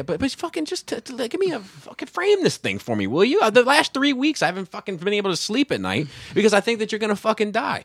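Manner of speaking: high-pitched, worked-up complaint; exasperated rant. Retold or imitated. Son berating dad for weeks of worry, exasperated.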